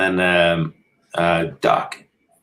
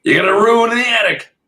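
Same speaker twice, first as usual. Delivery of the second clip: high, loud mock-Australian holler; Australian accent; 6 dB louder than usual. American guest teasing an Australian, put-on Australian accent. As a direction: pitch it way up in an Aussie accent. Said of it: "That's a good accent".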